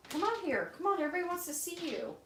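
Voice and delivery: high pet talk. Real, not acted. Coaxing the pet out.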